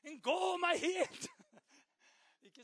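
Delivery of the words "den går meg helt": loud, high, slow desperate cry; hammed-up desperate parent yelling at God. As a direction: wail it like a parent at wits' end. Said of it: "om du nå kjenner at du blir desperat".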